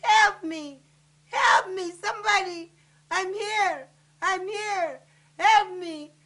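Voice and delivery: loud pleas for help, each falling away; crying out. Acted. Trapped voices calling to her in sleep, crying out. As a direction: call desperately from under the rubble. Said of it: "the voices crying out".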